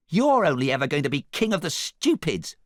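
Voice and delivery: high, loud taunt; squeaky indignant jeer; blurted insult. A narrator's reading. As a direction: blurt it defiantly. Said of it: "blurted Gingy".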